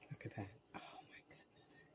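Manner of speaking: soft awed whisper. Real, not acted. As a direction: hush it out in wonder. Admiring the plated dish.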